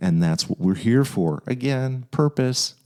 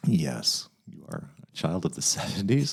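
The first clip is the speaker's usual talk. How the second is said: teasing echo; 3 semitones lower, 7 dB quieter than usual. Real, not acted. Teasing echo of her whisper.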